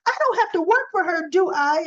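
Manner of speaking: whiny protest. Retold or imitated. Diane protests to her boss.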